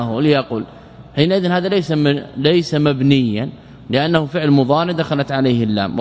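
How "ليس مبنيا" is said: ordinary delivery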